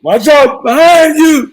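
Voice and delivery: high, loud, drawn-out shout; mock warning yell. Friend joking about yelling at a scary movie, mock-panicked shout. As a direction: holler a playful warning at the screen.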